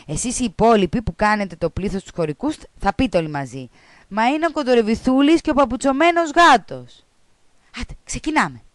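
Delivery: the teacher running the rehearsal; teacher briskly directing the kids. Acted.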